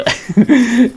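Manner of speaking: high embarrassed laugh; a quick flustered giggle; shy giggle. Real, not acted. Embarrassed after asking viewers.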